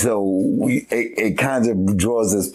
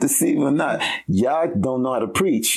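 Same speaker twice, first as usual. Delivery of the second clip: 1 mocking; 7 semitones higher than usual. Mocking the Pharisees, high pitch.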